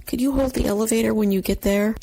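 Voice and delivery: high, quick, sweetly polite request; put-on niceness, high and hurried; really nicely. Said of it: "really nicely".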